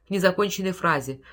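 ordinary delivery

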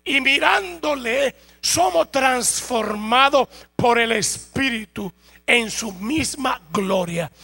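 loud, driving sermon delivery with slow emphatic stretches; fervent preaching. Acted. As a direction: preach it out full force to the congregation. Preaching at full force.

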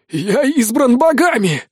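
high, slow desperate rasp; dying wheeze. A narrator's reading. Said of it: "хрипит он".

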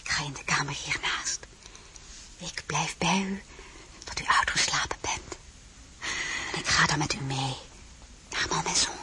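soft unvoiced whisper; soothing whisper. Désirée whispers reassurance to the drowsy empress. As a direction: lull her gently.

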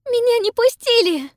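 high, loud sobbing wail. A narrator's reading. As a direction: wail it through sobs. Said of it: "рыдает Пиппа".

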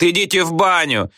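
high-pitched rude heckle; yelled jeer. A narrator's reading. Back-row pupils tell the teacher off, yelling. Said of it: "орали с задних парт".

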